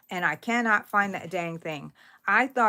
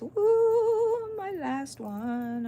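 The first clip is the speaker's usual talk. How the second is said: excited woo; 13 semitones higher, much slower than usual. Real, not acted. Finishing her last round.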